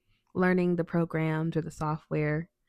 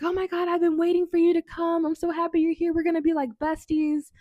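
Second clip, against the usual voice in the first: much higher, louder, squealing delight; gushing coworker; 10 semitones higher, 3 dB louder than usual. Retold or imitated. New coworker greeting her, gushing high and loud. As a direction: gush like an overjoyed new friend.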